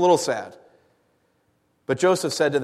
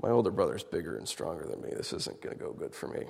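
fading to a whisper; a kid's worried whisper; nervous hush; 11 semitones lower, 13 dB quieter than usual. Retold or imitated. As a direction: mutter it to yourself afraid. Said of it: "being like".

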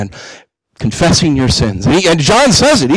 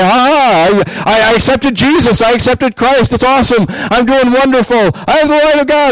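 higher, bright mock boasting about the light; smug overconfident believer; 8 semitones higher than usual. Preacher mocking Christians who think they're fine, higher, boastful. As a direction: play the cocky believer, pitched up.

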